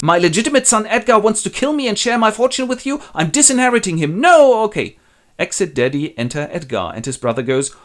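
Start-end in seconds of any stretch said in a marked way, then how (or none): 0.0-5.0 higher, louder outraged dismay; father reading the letter aloud; shocked old father. Acted. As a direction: reel at the betrayal.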